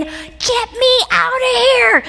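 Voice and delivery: squeaky slowed-down fake dread; mock panic. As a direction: beg to escape like a scared kid, hammed up. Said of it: "I was saying".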